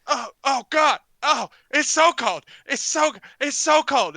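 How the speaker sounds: loud, high cries of cold shock; yelping from cold. Acted. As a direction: cry out like you just hit icy water. Heckler dunked in cold water cries out.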